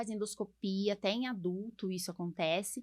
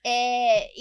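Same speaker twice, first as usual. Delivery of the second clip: laughing; 9 dB louder than usual. Real, not acted. Still laughing after the joke.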